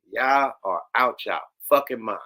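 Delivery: ordinary talk, nothing marked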